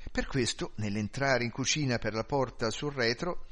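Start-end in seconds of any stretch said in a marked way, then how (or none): none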